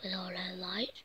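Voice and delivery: whisper. Acted. Whispered instruction.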